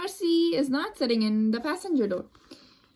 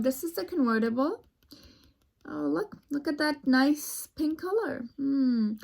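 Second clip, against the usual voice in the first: usual speaking voice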